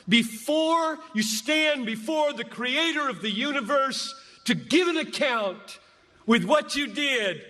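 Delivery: usual speaking voice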